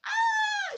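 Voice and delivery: high, loud mock shriek; hammed-up movie scream. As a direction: shriek like the lightning victim. Imitating a character screaming.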